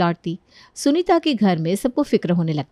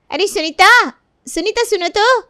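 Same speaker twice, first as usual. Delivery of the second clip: much higher call across the house; 12 semitones higher, 4 dB louder than usual. Acted. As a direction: call Sunita from the room. Calling out to Sunita. (कमरे से सुनीता को पुकारती भाभी)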